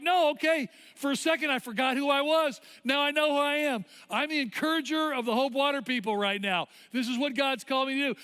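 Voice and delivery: high, loud, emphatic declaration; fired-up confidence. Retold or imitated. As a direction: proclaim it as the cheerleader back in form. Acts cheerleader regaining her confidence.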